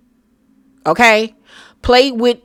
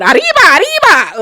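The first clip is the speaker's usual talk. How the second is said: high loud arriba arriba; mock a cartoonish Mexican cry; mock Spanish; 10 semitones higher, 5 dB louder, faster than usual. Host mocking Denise, cartoonish Spanish cry.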